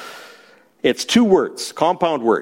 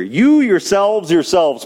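You hit first, slow and deliberate, yourselves repeated; emphatic you yourselves; 4 semitones higher, slower than usual. Shown as a demonstration. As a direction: drive home the You yourselves, yourselves. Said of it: "so they don't miss the emphasis".

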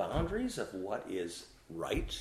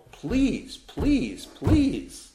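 higher, louder begging please; pleading; 9 semitones higher, 5 dB louder, much slower than usual. Shown as a demonstration. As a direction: plead over and over, rising. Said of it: "Let me plead with you from the word".

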